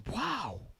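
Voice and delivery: high-pitched voice of an amazed grandma. Acted. Grandma seeing the microwave steam, high-voiced amazement. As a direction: play Grandma marvelling in a high voice. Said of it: "Grandma goes".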